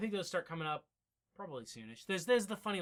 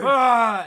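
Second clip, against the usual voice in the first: startled cry; 7 semitones higher, 17 dB louder than usual. Retold or imitated. Marshal's cry on waking.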